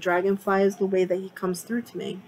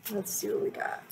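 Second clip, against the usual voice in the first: whisper; 12 dB quieter than usual. Real, not acted. Muttering to herself while shuffling.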